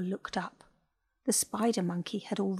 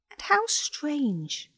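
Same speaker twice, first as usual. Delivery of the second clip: wondering; 7 semitones higher, much slower than usual. A narrator's reading. Ava marvels at odd day.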